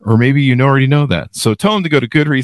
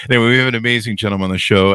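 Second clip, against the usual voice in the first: normal talking voice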